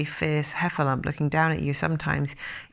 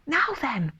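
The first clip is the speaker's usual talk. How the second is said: would-be easy; 8 semitones higher than usual. A narrator's reading. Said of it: "in the most delightfully easy way".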